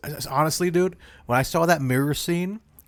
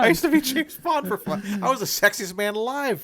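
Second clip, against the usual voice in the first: mock Connery; 7 semitones higher than usual.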